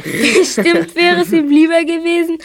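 laughing. Acted. Teasing with a laugh.

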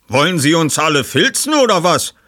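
empörter Mann, hoch und laut; erbost. Acted. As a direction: aufgebracht zurückfragen. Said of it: "fragte ein Mann erbost". (aufgebrachter Gast fährt den Ermittler an)

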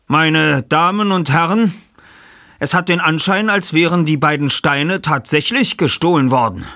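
förmliche Ansprache. Acted. Ansprache an die Gäste.

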